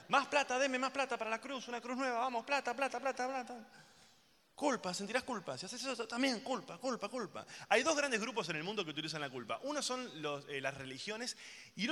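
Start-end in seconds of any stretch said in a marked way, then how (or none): 0.1-3.6 higher mock priest begging for money; a hint of a greedy church voice. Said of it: "la religión es dame, dame". 4.6-7.5 preachy guilt. Retold or imitated. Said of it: "La religión es todo culpa".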